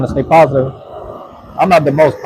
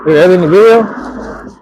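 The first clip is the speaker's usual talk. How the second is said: surprised; 8 semitones higher, 6 dB louder, slower than usual. Real, not acted. Reacting to chat message.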